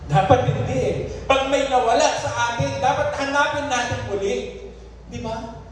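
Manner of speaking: high, urgent exhortation from the pulpit; impassioned preaching. Real, not acted. Preacher urging church to seek what was lost, impassioned preaching. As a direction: press the congregation in a sustained high voice.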